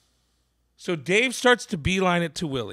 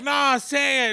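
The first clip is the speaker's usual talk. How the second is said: higher, louder crowd call-back; mock crowd shout; 9 semitones higher, 5 dB louder, slower than usual. Audience eggs the comic on, mock crowd shout. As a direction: call it up to the stage like an egging-on crowd.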